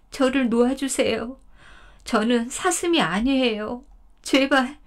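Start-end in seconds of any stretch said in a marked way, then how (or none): 2.0-4.8 high, imploring deer voice, begging grandfather; pleading deer. Acted. 덫에 걸린 사슴이 할아버지에게 애원하듯. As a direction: beg him to let you go.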